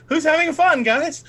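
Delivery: high, singsong fake cheer; mock cheerful. GM teasing players after grim scene.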